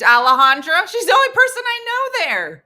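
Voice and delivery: high, loud burst of despair; loud exclaim. Retold or imitated. Telling the crowd she caved, loud and high. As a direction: wail her name in mock defeat. Caving, calling out friend's name.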